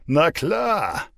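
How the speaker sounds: high, loud sarcastic jeer; mocking. Drunk boyfriend scoffing at the mother's threat.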